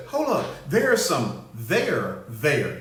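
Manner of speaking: mock inspector general. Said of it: "Y'all notice they phrase".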